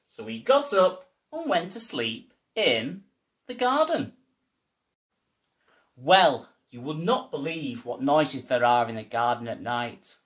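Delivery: ordinary delivery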